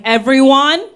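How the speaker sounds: loud rising call for attention; teacher calling out. Retold or imitated. Teacher hushing a noisy class, loud rising call. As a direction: call the kids to order like a teacher.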